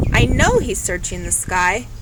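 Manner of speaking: plain reading voice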